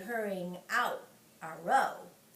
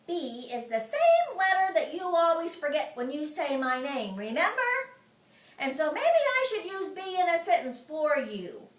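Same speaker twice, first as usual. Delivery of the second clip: taunting; 6 semitones higher, 4 dB louder than usual. Acted. Junie taunting May.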